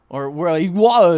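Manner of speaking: mock Boston accent on "water", dropped r and t, "wah-tuh". Streamer teasing a Boston viewer, mock Boston accent on "water". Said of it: "accent somewhere else".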